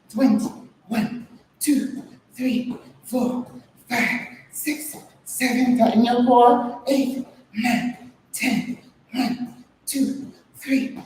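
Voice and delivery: counting reps mid sit-up; strained counting while doing sit-ups. Real, not acted. Counting reps during sit-up workout.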